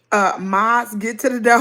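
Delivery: ordinary talk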